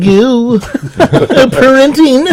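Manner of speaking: very high, slow nerdy voice; nerd voice. Acted. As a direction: stretch it out in a goofy nerd voice. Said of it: "This is my nerd voice".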